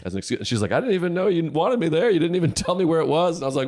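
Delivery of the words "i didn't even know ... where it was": high, whiny complaint; mock hurt girlfriend. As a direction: sound hurt and put out.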